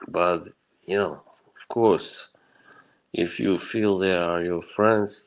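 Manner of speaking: ordinary delivery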